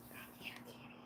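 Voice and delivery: breathy hush calling the cat; whispered coaxing. Real, not acted. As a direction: coax the kitty over without a sound.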